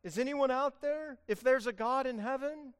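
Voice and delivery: hammed-up skeptic calling upward; doubtful call to heaven. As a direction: cry out as if unsure anyone hears. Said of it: "it's not that".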